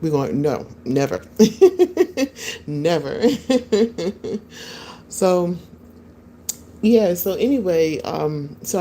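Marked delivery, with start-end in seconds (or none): 1.2-2.6 high, loud burst of laughter; laughing. Real, not acted. Woman answering a viewer's question, high laughing burst. 3.2-5.0 high-pitched run of giggles; laughing. Real, not acted. Woman after saying never to a viewer, high giggling.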